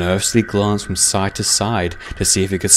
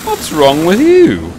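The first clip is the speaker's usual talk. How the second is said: high, loud, drawn-out question; workman's voice pitched well up, slowly asking; puzzled workman; 10 semitones higher, 7 dB louder, slower than usual. A narrator's reading. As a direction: ask it big and high, drawn out. Workman questions startled Bear.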